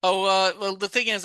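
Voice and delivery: ordinary talk, nothing marked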